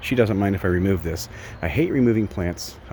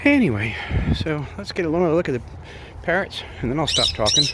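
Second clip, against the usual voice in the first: ordinary talk, nothing marked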